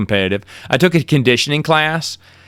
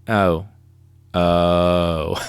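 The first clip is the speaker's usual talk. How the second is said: low, drawn-out crestfallen oh; a lightly voiced sinking heart; deflated oh; 6 semitones lower, 3 dB quieter than usual. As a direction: let the air out of the oh. Deflated reaction to rejection.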